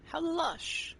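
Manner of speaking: high mock-swooning praise; mock admiring. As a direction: coo it like a snob.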